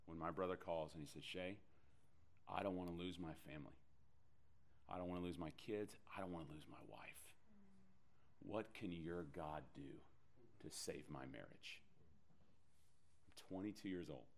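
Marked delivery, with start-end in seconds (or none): 1.2-11.8 quiet retold crying plea. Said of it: "he's crying on the phone".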